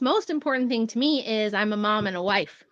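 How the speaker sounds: ordinary talk, nothing marked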